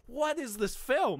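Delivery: high, slow incredulous question; baffled what. Retold or imitated. To a friend, recalling watching the film, incredulous high question.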